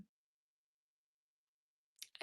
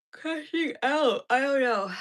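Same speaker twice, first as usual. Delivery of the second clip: much higher, louder and slow, hammed-up despair; dramatic crash out; 6 semitones higher, 10 dB louder, slower than usual. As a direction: wail it like you're totally done. Exaggerating exhaustion after "I'm done.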